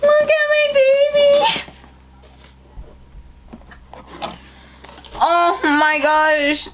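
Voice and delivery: crying over her babies; happy crying. Real, not acted. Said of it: "I'm getting so emotional".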